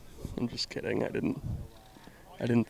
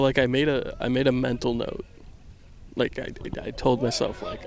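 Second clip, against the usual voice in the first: usual speaking voice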